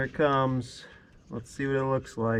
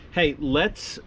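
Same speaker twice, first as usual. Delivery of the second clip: normal talking voice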